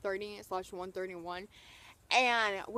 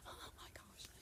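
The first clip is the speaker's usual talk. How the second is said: voiceless, hushed re-enacted awe; starstruck whisper; 21 dB quieter than usual. Fan retells seeing the star, awestruck whisper. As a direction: whisper it as if overwhelmed.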